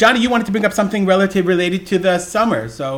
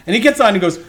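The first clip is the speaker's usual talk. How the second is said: ordinary delivery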